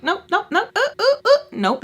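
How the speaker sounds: high insistent nope, nope; cutting off. Retold or imitated. Narrator talking over Alexa, high and insistent. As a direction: shut her down before she finishes.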